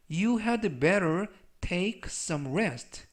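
slow model sentence. Shown as a demonstration. Teacher reading the example sentence aloud, very slow. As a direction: Keep your belongings in the locker read very slowly. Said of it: "slow model reading of sentence".

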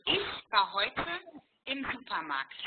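slowed down, each word laid out for the learner; slow repeat. Shown as a demonstration. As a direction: say it again slowly and clearly. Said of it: "What was the second word?". (langsam wiederholt)

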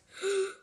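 startled high gasp; sharp gasp. Acted. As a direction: gasp in surprise. Startled by strangers.

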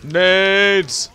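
loud, high shout; sudden high call; called-out warning. Real, not acted. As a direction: yell it out to teammates. Calling out grenades in the game.

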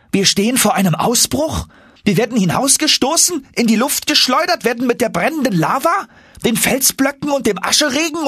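very high, frantic youthful voice; the nephew spiralling into panic; panicked young Axel. Acted.

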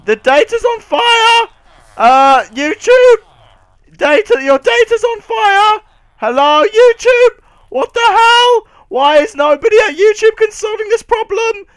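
high, loud cries of alarm; alarmed yelling. Pretend. Player warns YouTube its data is on fire, alarmed yelling. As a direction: call out urgently for someone to deal with it.

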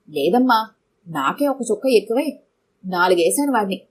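usual speaking voice

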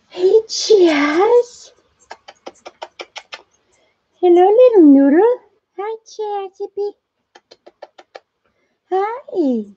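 high, sing-song pet coo. Real, not acted. Owner coos high at her chipmunk called little noodle. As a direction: gush over a tiny pet.

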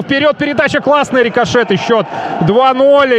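excited goal call. Real, not acted. Second goal called live.

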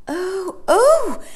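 high, loud shivering cry. A narrator's reading. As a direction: gasp in shock and cold. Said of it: "cried Jane".

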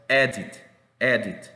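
"edit" with a short, slightly smiled E; careful, separate "edit"; short E demonstration. Shown as a demonstration. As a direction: model "edit" firmly for learners. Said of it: "una sonrisa no tan prolongada".

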